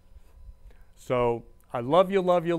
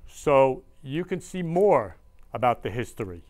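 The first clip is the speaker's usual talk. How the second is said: ordinary talk, nothing marked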